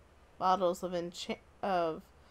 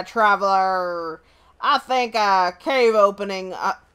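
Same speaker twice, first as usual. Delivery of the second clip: pirate villager voice; 7 dB louder than usual. Acted. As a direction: play a salty old sailor, booming. Shipwreck villager greets traveler.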